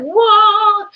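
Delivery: high, loud delighted whoa; joyful whoa. Pretend. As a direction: squeal with delight as the happy figure. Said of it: "He's like".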